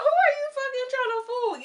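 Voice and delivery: high shrieking disbelief, very loud; incredulous shout. Real, not acted. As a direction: scream it in disbelief at the lie. Mocking castmate's excuse, shouting.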